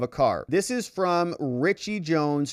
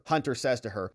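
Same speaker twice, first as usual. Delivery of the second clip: ordinary delivery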